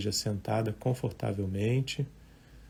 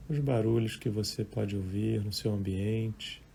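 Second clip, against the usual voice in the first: guided meditation; 9 dB quieter than usual. Real, not acted. Meditation teacher leading live listeners through breathing and thoughts, calm and unhurried. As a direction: guide listeners gently, leaving long silences between short phrases.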